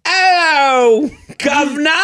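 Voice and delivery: hammed-up cockney chimney sweep; mock cockney accent. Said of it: "speak like they're in the Mary Poppins era".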